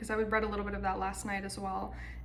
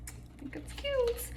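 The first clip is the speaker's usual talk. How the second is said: cutesy whisper; 14 semitones higher, 4 dB quieter, slower than usual. Real, not acted. Cooing over cute notebook.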